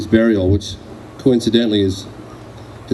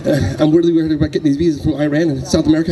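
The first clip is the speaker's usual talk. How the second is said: anxious rambling; 4 semitones higher, faster than usual. Retold or imitated. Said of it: "I started rambling".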